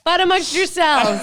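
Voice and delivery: high, loud, laughing refusal to take sides; hands-off protest, pitched high through laughter; mock alarmed protest. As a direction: back out of the fight in comic panic. Acted backing out of a fight.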